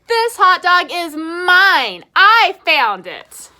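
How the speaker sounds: a pigeon guarding his food; possessive pigeon. Acted. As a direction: pigeon firmly claiming it, slow and loud. Pigeon claims the hot dog.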